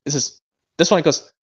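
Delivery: usual speaking voice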